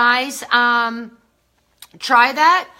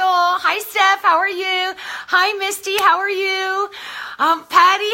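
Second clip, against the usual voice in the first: ordinary delivery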